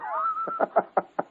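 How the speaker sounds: high-pitched burst of laughter. Real, not acted. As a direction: crack up at the joke. Laughing at his own story.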